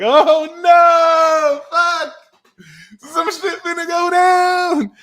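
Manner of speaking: high, loud panicked yelling as the scared people; mock panicked shout. People freaking out as you walk in, loud panicked shout. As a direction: scream it like doom is coming.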